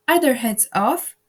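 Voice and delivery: loud shouted question; shouting. A narrator's reading. Queen checks on the execution, loud shout. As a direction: shout it across the garden. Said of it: "shouted the queen".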